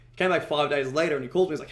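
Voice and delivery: usual speaking voice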